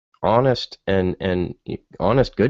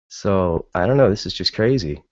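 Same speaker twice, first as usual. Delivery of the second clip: ordinary talk, nothing marked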